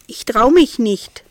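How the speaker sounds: lightly voiced whiny cat; timid mewling. A narrator's reading. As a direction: mew it like a reluctant kitten. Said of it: "maunzte Rosa".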